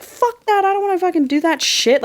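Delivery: mock the son's bratty outburst, high and petulant; temper tantrum. Son refusing the delivery orders, in a mock high tantrum. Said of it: "Like, throwing a temper tantrum".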